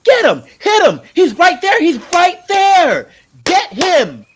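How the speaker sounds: high, loud frantic cheering; excited shouting. Acted. Boy on Dunk's shoulders urging a knight on, excited yelling. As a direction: yell at the knight to finish it. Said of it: "in his excitement".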